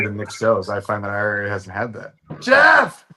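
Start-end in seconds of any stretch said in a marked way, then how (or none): 2.4-3.0 high, loud hailing shout; calling out. Real, not acted. Host hailing Jeff as he turns up, real shout. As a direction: holler the name in greeting.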